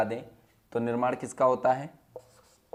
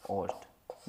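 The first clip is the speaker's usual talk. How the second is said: whispered answer; 8 semitones lower, 15 dB quieter, much slower than usual. Shown as a demonstration. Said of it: "और ये क्या था".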